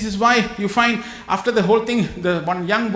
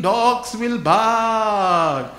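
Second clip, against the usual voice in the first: very slow, every word stretched; drawn-out proverb; much slower than usual. Acted. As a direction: let the proverb land slowly.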